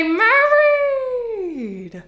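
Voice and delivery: very high, stretched-out disbelief; theatrically stunned mother; shocked echo. Acted. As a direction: draw the word out in high-pitched astonishment. Mother reacts to wedding news.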